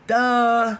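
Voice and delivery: loud, high mocking duh; sarcastic blurted duh. Real, not acted. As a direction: bark it like it's obvious. Mock obviousness.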